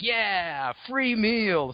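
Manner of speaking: high-pitched mock glee; playful gleeful cry. Juvenile T-Rex spotting a free meal, mock glee. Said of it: "and then he's like".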